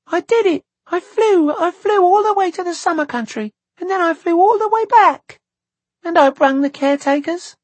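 put-on very high, loud, excited child; joyful cry. A narrator's reading. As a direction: burst out boasting in a squealing little-girl voice. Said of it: "she cried out".

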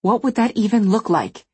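plain reading voice

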